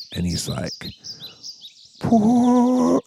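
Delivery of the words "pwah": high, robotic vocoder warble on pwah; vocoder imitation. Bon Iver answering the chicks, mock vocoder warble. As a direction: mimic an autotuned vocoder note, pitched high. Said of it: "like with the fucking vocoder".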